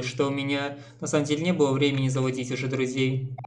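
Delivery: ordinary delivery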